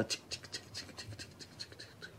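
whispered clicking ticks; ticking noise. Shown as a demonstration. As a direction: tick softly like a clock, under breath. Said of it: "Agora faz assim, ó".

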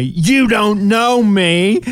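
slow high drawn-out "You don't know me"; playing the teen girls calling out; mock teen call. High slow teen girls calling out to him at dinner. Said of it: "they yelled at me".

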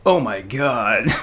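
slow, low put-on voice; another voice. Shown as a demonstration. Mimicking his own odd voice for friends, put-on. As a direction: draw it out as if someone else spoke. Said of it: "like I had another voice when I said".

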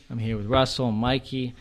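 usual speaking voice

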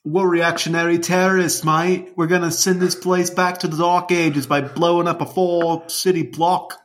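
hammed-up British villain; mock British accent. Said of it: "it's like a Liverpudlian Australian".

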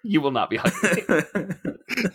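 slow and emphatic, retold as utterly serious; dead serious. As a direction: imitate a student solemnly laying down the law. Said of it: "serious voice I've ever heard".

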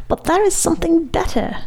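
high-pitched, moaned plea; moaning. A narrator's reading. Maestro offering the Count something better, moaning. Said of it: "moaned the maestro".

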